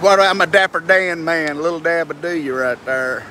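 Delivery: ordinary delivery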